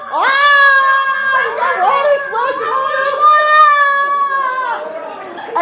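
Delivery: high-pitched playful storm yelling; mock panic. Pastor and children get splashed in pretend boat, mock panic. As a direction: squeal as the pretend waves hit.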